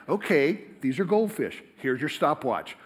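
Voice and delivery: ordinary talk, nothing marked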